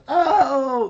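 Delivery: cartoon kid loud oh; loud high cartoon gasp. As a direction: burst out with a shrill oh. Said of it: "he actually goes".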